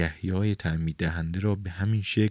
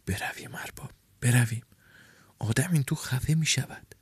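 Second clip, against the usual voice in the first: hushed murmur in his ear; urgent whisper; 5 semitones higher, 3 dB quieter than usual. A narrator's reading. Restless man urges his boss to leave, hushed whisper.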